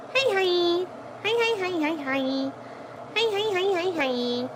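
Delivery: high, soft fading hi hi hi; soft cooing his. Real, not acted. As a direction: coo gently and softly to the pet.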